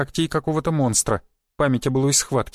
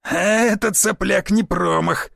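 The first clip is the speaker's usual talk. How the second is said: drawn-out, higher, husky call; husky shout; 5 semitones higher, slower than usual. A narrator's reading. Onlooker cheering the cheeky newcomer, husky drawn-out call. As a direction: holler it huskily from the crowd. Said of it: "крикнул сиплый голос".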